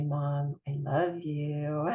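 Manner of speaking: soft, slow, imitating a loving teen; tender child voice. Teen telling mom they love her, mock tender and soft. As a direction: say it gently as a kid to mom.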